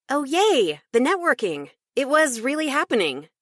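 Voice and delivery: high, bright burst of glee; thrilled. Acted. Baker thrilled at a networking chance, excited. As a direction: squeal inwardly with joy.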